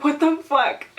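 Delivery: high-pitched incredulous outburst, retold; shocked disbelief. Retelling her own reaction in the salon, shocked. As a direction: act out total disbelief. Reenacts shock at missing water.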